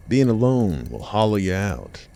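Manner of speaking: ordinary delivery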